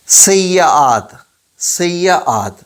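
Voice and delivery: سیئات stretched slowly, loud and high; drawn-out model word. Shown as a demonstration. Teacher stretching سیئات slowly for the class. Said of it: "آگے ہے لفظ".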